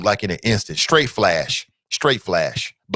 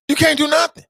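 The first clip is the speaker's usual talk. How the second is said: high emphatic; 4 dB louder than usual. Real, not acted. Describing Achilles pain.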